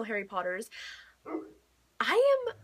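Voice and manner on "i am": excited. Real, not acted. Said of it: "I am so excited".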